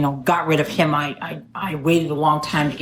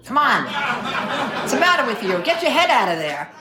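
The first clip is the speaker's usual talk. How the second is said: higher, laughing mock scold; laughing tease; 6 semitones higher than usual. Real, not acted. Speaker teasing the AA audience about her joke, laughing mock scold. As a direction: playfully scold the dirty-minded crowd.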